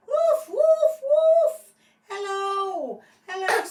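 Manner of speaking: very high, loud woofing dog; excited dog voice. Acted. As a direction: bark like an overjoyed puppy. Dog greets owner excitedly.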